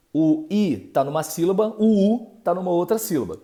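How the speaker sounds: ordinary talk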